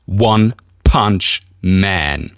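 very slow, loud, stretched-out fighter name; boxing ring announcer. Pretend. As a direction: drag out the name like a title fight. Boxing ring intro of his fighter.

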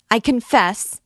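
panicked, rising shriek. A narrator's reading. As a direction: scream the repeat in agony. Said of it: "cried the miserable girl".

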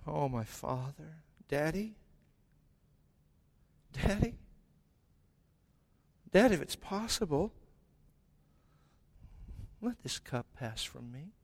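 a hint of a pleading Jesus at prayer; Jesus praying quietly to his father. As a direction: pray softly, a lightly voiced plea. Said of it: "he prays".

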